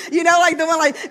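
laughing through the words. Real, not acted. Mother laughing about her daughter reading her journal. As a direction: chuckle while talking.

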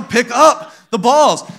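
loud, high, drawn-out mock order; exasperated. Parent who keeps repeating it to toddlers, exasperated. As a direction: stretch it out like a parent at wit's end. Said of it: "I'm gonna lose my mind".

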